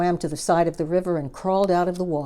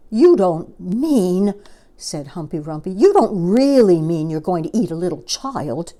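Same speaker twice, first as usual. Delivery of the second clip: horrified hippo; 6 dB louder than usual. Acted. Hippo horrified at the crocodile's plan. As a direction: dread the answer.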